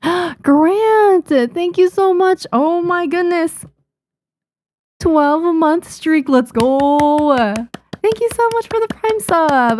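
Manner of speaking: high, slowed, gushing thanks; excited thanks. Real, not acted. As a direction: squeal your gratitude. Streamer thanks subscriber excitedly.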